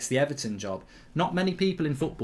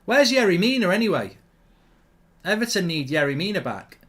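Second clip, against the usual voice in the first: frustrated; 6 semitones higher, 5 dB louder than usual. Real, not acted. Frustrated at Everton's defence.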